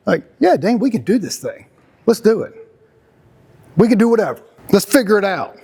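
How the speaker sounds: rally your partner like it's easy; upbeat encouragement. Pretend. Husband rallying Dean through a problem, upbeat and eager. Pep talk voiced to Dean.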